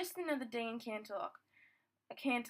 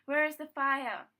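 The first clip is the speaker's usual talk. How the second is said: loud, clear, raised-pitch question; 4 semitones higher, 9 dB louder than usual. A narrator's reading. Celestia questioning two fillies about a fire, clear and loud. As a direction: project it like a ruler taking charge. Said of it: "in a clear voice".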